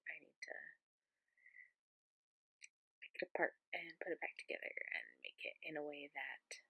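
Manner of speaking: breathy hushed whisper; library whisper. Real, not acted. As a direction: keep it barely voiced. Whispering late at night.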